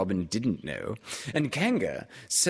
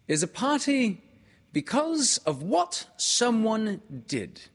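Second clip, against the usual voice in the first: put-on high, loud boyish voice; an excited boy making a speech; Christopher Robin's voice; 9 semitones higher, 4 dB louder than usual. A narrator's reading.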